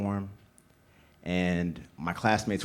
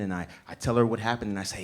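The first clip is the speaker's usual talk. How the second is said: ordinary talk, nothing marked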